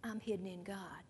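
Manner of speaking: hushed testimony. Retold or imitated. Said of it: "His testimony was".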